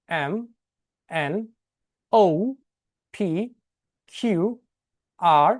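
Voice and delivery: each letter said slowly and clearly with pauses; careful alphabet recital. Shown as a demonstration. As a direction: model each letter for the class to copy. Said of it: "আমি আরেকবার বলছি". (প্রতিটি অক্ষর ধীরে স্পষ্ট করে উচ্চারণ)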